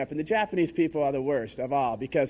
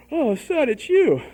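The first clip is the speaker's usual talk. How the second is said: mock relieved dad; 7 semitones higher, 6 dB louder, slower than usual. Dad at door, retold.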